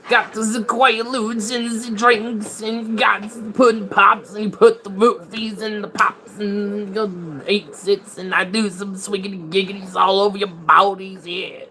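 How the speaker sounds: Bill Cosby impression. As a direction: ham up a goofy Cosby voice. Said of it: "you got Bill Cosby'd".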